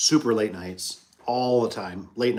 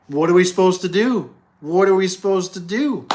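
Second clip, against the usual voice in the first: higher, louder cry of frustration; loud, fed-up "what are we supposed to do"; exasperated outburst; 6 semitones higher, 6 dB louder than usual. Real, not acted. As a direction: throw the question up in despair. Venting about the impossible bind.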